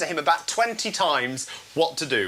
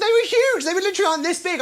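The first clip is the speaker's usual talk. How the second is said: much higher, louder burst of amazement; amazed; 12 semitones higher, 3 dB louder, faster than usual. Real, not acted. Host telling viewers how huge the potatoes were, amazed and high. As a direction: marvel at the size.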